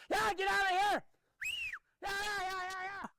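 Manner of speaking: high-pitched yeahs driving the mule off; shooing calls. Real, not acted. Trainer drives the mule out of his space with high calls. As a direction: shoo the mule away with sharp high calls.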